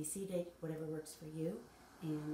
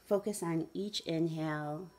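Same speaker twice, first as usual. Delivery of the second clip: measured meditation guide with long pauses; guided meditation; 6 dB louder than usual. Acted. Guide asking listeners to follow the breath, guided meditation. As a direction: lead them gently through it, unhurried.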